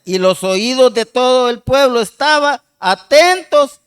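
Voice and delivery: loud, slow proclamation of a Bible verse; declaimed Bible reading; scripture reading. Acted. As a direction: read it out firmly to the congregation, stretching the words. Reads the scripture aloud.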